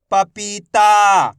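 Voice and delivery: whole word in one go, vowels held; syllables joined. Shown as a demonstration. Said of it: "तो मिलके बन जाएगा".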